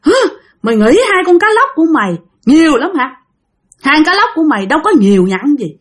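indignant, menacing challenge with huffs; aggressive. Acted. Demon confronts the woman over withheld fish, aggressive. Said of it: "nói một cái giọng hung hăng lắm".

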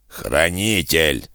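slow, wet gurgling monster. Acted. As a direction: gurgle it out like a drowning beast. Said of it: "пробулькал голос".

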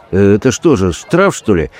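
usual speaking voice

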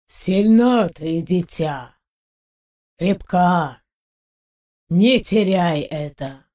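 медленный, низкий голос голема; проскрипи медленно и низко, как камень о камень; скрипучий каменный голем. A narrator's reading. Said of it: "Его голос казался трением камней друг о друга, скрипом". (скрипучий голос каменного великана, слова врастяжку)

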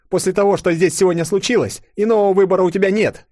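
high, loud urgent persuasion; forceful insistence. A narrator's reading. Yulia insists the princess leave with them, forceful. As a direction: press her hard to come along.

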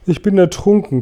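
high, spluttering story-character gasp; acted breathless complaint. A narrator's reading. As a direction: gasp it out as if half drowned. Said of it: "keuchte Grashüpfer".

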